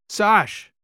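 high, loud call down the hall; calling his niece in a hurry; shouted name. A narrator's reading. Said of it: "Kikoa shouted to his niece".

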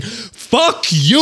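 high-pitched, drawn-out defiant comeback; mock defiant retort. Film fan quotes a man charging at a gun, mock defiance. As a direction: imitate a grump defying an armed guard. Retold defiance of armed guard.

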